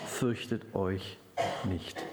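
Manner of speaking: put-on very slow and soft, like a father to children; fatherly comfort. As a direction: hush the fear out of them gently.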